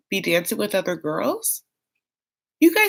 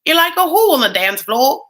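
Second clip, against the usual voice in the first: louder, higher badly faked Cockney with dropped letters; bad Cockney accent; 6 semitones higher, 5 dB louder than usual. Ringtone request, badly faked Cockney accent. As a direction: overdo the Cockney and miss it. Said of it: "That's not how she says it".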